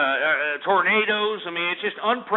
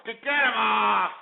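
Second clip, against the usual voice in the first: shouted order; 5 semitones higher than usual. Real, not acted. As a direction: bark it at the producer. Host orders caller dropped.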